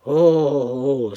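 loud anguished oh's of despair; wailing. A narrator's reading. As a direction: wail out the loss. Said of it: "avec un hurlement terrible". (gémissement fort et désespéré)

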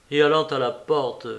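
usual speaking voice

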